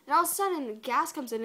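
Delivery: usual speaking voice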